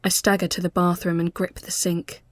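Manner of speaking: usual speaking voice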